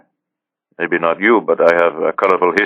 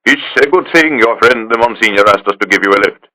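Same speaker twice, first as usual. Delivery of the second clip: a louder, higher Italian police captain; Carabinieri captain; 4 semitones higher, 4 dB louder than usual. Acted. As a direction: play the captain, brisk and official.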